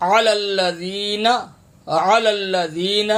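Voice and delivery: slow recitation of علی الذین with throaty ain; correct ain. Shown as a demonstration. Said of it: "یہ عین زبر آ". (علی الذین ٹھہر کر، عین کے ساتھ)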